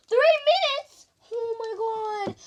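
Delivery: high, loud alarmed question; shocked disbelief. Real, not acted. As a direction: gasp out the time in shock. Shocked at timer.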